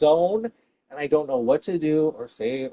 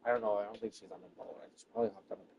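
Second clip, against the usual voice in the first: much softer, lower muttered aside; 6 semitones lower, 16 dB quieter, much faster than usual. Real, not acted. Comic muttering a correction to herself, softly.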